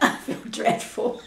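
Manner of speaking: helpless laughter through the words; can't stop laughing while admitting it; laughing while upset. Real, not acted. [name] answering how she feels about the car trouble, laughing helplessly. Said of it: "I can't stop laughing".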